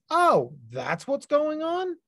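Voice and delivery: high, slow, drawn-out "oh, I get it" voice; brain's sudden realization. Retold or imitated. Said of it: "your brain goes".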